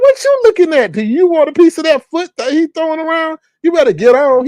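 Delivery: high-pitched comic challenge to an onlooker; mock confrontation. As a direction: call him out in a high, incredulous voice.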